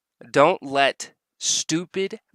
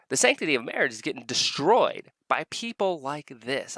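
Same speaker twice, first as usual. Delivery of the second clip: ordinary talk, nothing marked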